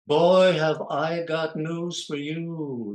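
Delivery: high, loud, very slow put-on voice; a loud, slow showbiz impression. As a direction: drag it out in a celebrity voice.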